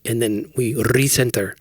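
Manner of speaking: "recenter" with a trilled opening R, slowly; rolled R. Shown as a demonstration. Imitating the prayer app narrator, "recenter" with a rolled R. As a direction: roll the R hard at the start of "recenter". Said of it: "I get to do the, the rolling of the R's".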